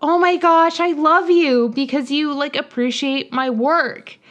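high, loud, imitated adoration; mock-overjoyed declaration of love; gushing gratitude. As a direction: gush as if finally appreciated. Acts Alex gushing at a fan.

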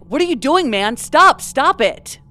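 a hint of desperate shouting; retold desperate yelling. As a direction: read it as a desperate yell, slowing on stop. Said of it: "sound more desperate ... yelling".

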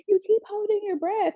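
high-pitched mock complaint; imitating nagging nurse. Nurse in her ear during labor, mock nagging.

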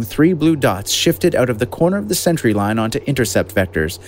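ordinary delivery

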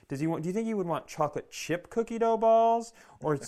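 high, fast, overeager wondering; hopeful uncle planning treats for nephew; thirsty uncle. Retold or imitated. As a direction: fuss like a needy uncle shopping. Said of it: "real thirsty uncle type stuff".

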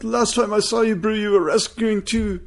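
put-on quoting a friend in a South African accent; high, slow South African voice. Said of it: "That was a solid South African accent".

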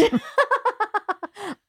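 a bright, high burst of real laughter. Real, not acted. Laughing at her own story.

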